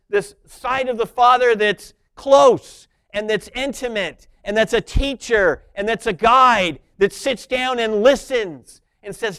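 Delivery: normal talking voice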